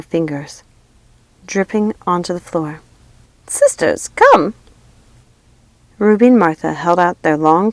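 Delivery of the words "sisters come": summoning. A narrator's reading. Lucinda summons sisters.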